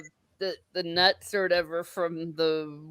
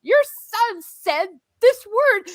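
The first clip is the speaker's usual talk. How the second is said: slow, high, louder scandalized mother; on the phone, appalled, much higher; scandalized mom; 11 semitones higher, 6 dB louder, much slower than usual. Retold or imitated. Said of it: "she's like".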